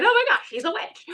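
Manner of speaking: high, gasping mock accusation; mock witch-hunt accuser. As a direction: play a horrified villager spotting a witch. Mocking townsfolk accusing a witch.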